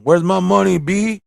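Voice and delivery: lightly hammed-up pimp. Jitsu demanding his money, mildly hammed-up. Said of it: "Let me do it again".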